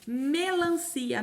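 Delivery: "melancia" said slowly with the first syllable clear; teacher stretching "melancia"; slow syllable demo. Shown as a demonstration. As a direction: sound out the start of "melancia". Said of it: "me, me".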